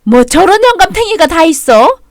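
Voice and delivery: fuming outburst. Acted. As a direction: vent your fury at the old man.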